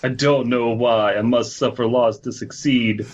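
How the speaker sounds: normal talking voice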